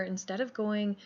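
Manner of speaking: ordinary delivery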